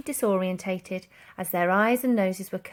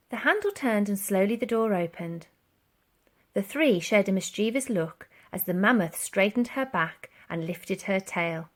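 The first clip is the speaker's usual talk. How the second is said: ordinary delivery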